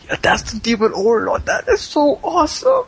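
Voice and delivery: put-on high-pitched, starstruck young kid; gushing fan. Young kid fawning over the demon overlord, gushing. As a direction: squeal it like an adoring young fan. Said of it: "looks like a fan of this guy".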